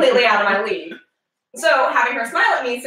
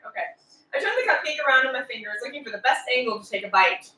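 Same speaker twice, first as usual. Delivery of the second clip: ordinary talk, nothing marked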